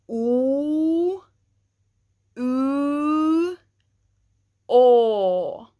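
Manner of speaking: "Oo, oo, o" held, the last "o" loud; vowel drill together. Shown as a demonstration. Teacher leading class through three vowels. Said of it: "let's try it one time together".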